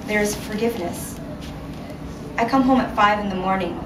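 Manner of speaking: ordinary delivery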